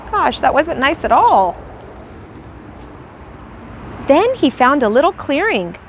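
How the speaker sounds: ordinary delivery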